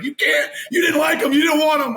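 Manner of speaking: high-pitched mock yelling; retold yelling. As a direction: imitate someone yelling in heated protest. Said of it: "he just kept yelling at me".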